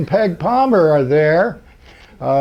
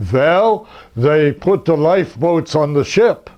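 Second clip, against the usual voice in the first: a light imitation of a heavy German accent; gently mocking German accent; thick German accent; 2 semitones lower than usual. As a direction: do the quote as a German rocket scientist would, mildly. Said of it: "in a thick German accent".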